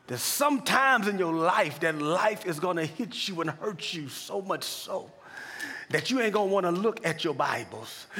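preacher lifting the congregation toward the name of Jesus; preaching at full force. Acted. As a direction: build the sermon to a rousing peak. Preaching builds over music.

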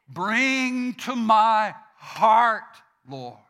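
fervent prayer. Acted. Preacher praying aloud before the congregation, fervent and slow. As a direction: stretch each word out in prayer.

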